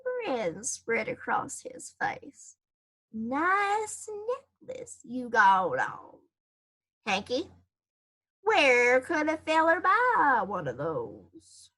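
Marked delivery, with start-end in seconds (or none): 3.1-11.7 lightly voiced sly cat, slow and teasing; smug cat. Lazy cat Pete teases Hank about his necklace, slow and smug. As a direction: drag the words out in a lazy mocking purr. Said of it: "He yawned and a big grin spread".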